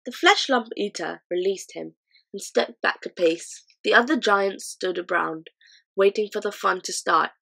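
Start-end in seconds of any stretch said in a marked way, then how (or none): none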